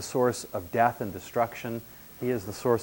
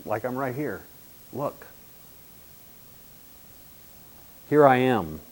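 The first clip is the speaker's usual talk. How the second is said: sarcastic; 3 semitones higher, 5 dB louder than usual. Retold or imitated. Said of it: "I'm a little suspicious".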